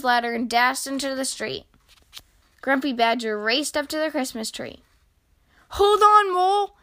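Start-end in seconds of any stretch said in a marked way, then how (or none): 5.7-6.7 high, loud rescue call; urgent cry. A narrator's reading. Grumpy Badger races to rescue Mole, crying out. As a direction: call up to someone in danger. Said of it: "he cried".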